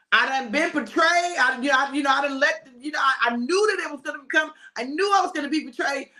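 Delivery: high, halting appeal; pleading protest. Acted. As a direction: argue your case to God, stumbling over words. Jesus protesting his task to God.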